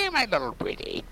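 high witch cackle and line; Wicked Witch. Retold or imitated. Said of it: "sounds kinda like the Wicked Witch".

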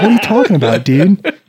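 can't believe what he's hearing; 1 amazed disbelief. Retold or imitated. Recruit hears about the bonus, laughing in disbelief. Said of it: "I'm like".

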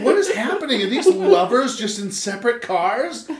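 high, puzzled bystander voice, laughing; baffled bystander. Pretend. Bystander watching the lewd drivers, high baffled voice. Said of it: "someone next to us is just gonna be like".

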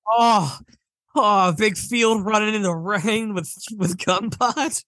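hammed-up wistful delight; dreamy simpleton. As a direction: play a simple soul lost in a happy daydream. Playing eager Lenny to a reassuring friend.

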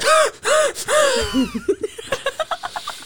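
high, wheezy mock sobs; asthma-attack sobbing. Showing friends his tearless crying, wheezing mock sobs. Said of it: "having an asthma attack".

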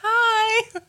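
very high, loud chirpy greeting; tiny high hello to a scary beast; Darla's cheery hi. Retold or imitated. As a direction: squeak it out sweetly. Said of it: "And she's just like".

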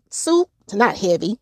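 ordinary talk

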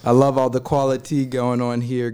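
ordinary talk

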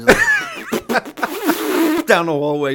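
slow, high, mock helicopter rumble; helicopter fart noise. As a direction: buzz it out like a helicopter. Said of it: "like a helicopter down the hallway".

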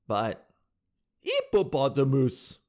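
put-on slow French-accented "hippopotamus". Host teases the French girl's attempt, French accent. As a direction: drop the H and stretch it, French-accented. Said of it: "French girl trying to do an American accent".

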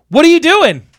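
high, loud mock outrage; indignant demand. Irate driver to man blocking the gate, mock indignant. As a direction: imitate an irate driver's incredulous question.

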